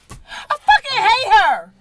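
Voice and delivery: anguished outburst. Acted. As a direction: shout out the hurt of a betrayed mother. Mother learns hated girl is daughter.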